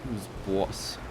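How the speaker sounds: terrible Boston take on boss; Boston accent. Pretend. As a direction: lean on a bad Boston accent. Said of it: "Very terrible Boston accent".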